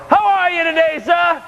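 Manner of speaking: a beaming doorman calling out hello; cheerful security guard. Acted. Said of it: "I hear [name]".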